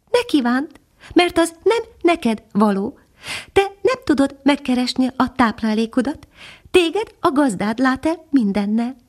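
a little hidden bird answering from the branches; tiny nightingale. Acted. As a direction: speak as a tiny, gentle songbird. Nightingale voiced in story.

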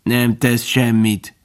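slow grasshopper rasp; reszelős szöcskehang. A narrator's reading. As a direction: rasp it out, drawing out the words. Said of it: "reszelte a szöcske". (lassú, reszelős hang)

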